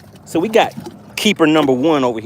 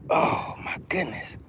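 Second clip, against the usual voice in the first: awed whisper; 11 dB quieter than usual. Real, not acted. Awed under breath fighting fish.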